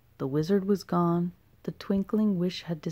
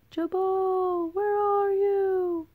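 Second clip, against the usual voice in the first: high, slow call from far off; faint distant call; 13 semitones higher, much slower than usual. A narrator's reading. Gertie searching in the storm, faint distant high call. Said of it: "A faint voice called in the distance".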